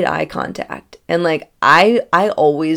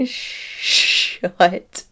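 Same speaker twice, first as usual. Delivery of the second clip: whisper; 2 semitones higher than usual. Retold or imitated. Teasing the man's guess.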